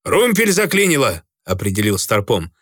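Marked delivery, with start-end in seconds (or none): 0.1-1.2 urgent call. A narrator's reading. Alarm on the bridge.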